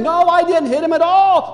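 loud, high-pitched protest; emphatic child denial. Acted. Six-year-old to accusing grandparent, emphatic denial. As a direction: insist on innocence like a kid.

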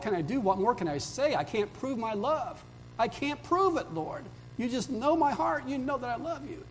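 high, pleading cry to the Lord; plead, voice lifted high and strained; pleading Peter. Retold or imitated.

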